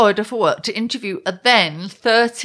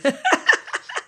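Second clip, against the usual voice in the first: cackling; 10 semitones higher than usual. Real, not acted. Laughing at her own U-turn joke with a cackle. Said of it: "Oh, cackling".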